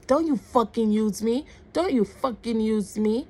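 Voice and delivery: acting out a man's angry warning; retold angry outburst. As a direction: snap it out twice as an accusation. Retold angry man confronting her.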